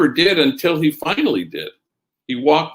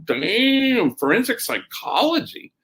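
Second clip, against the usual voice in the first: impressed amazement; 9 semitones higher than usual. Retold or imitated. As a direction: echo it back, mock-awed and high-pitched. Acts out his impressed reaction.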